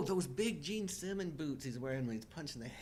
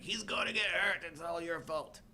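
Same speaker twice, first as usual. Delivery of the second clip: imitating Paulie; 6 semitones higher than usual. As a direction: bark it like angry Paulie. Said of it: "He's like".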